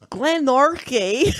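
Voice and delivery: slow attempt at a Scottish Glenorchy; Glenorchy as klænɔɹki, halting mock Scottish; Scottish accent. Shown as a demonstration. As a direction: say Glenorchy slowly with a Scottish lilt. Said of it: "my ac".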